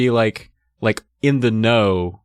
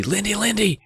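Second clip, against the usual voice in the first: urgent call; 10 semitones higher than usual. Acted. Waking sister in fright.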